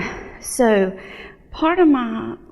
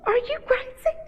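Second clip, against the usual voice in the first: husband's disbelief; 6 dB quieter than usual. Retold or imitated. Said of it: "And he was like".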